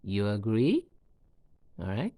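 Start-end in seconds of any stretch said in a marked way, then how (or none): 0.0-0.9 "agree" rising sharply into a question; rising intonation. Shown as a demonstration. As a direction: make a yes-no question by lifting "agree" at the end. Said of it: "with a rising intonation at the end of it".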